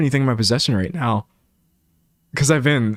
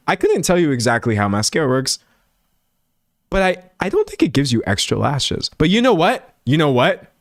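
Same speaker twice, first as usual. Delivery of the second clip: ordinary talk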